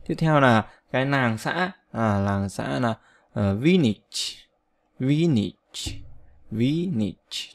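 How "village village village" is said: village stressed on the first syllable, two short i sounds; VIL-lij, stress on the first syllable, short i in both; pronunciation model. Shown as a demonstration. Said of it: "Trong âm thứ nhất nhá, hai âm y ngắn".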